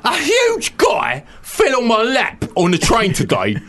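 hammed-up Cockney outrage. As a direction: holler it as an aggrieved Cockney. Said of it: "Can we do your Cockney?".

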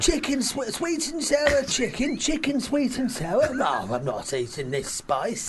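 slow, high bewildered reading, dropping into refusal; Len Goodman. Acted. Judge baffled by sweet and sour, mock disgust. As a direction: puzzle over an alien dish, then refuse. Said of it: "has to make Len Goodman eat".

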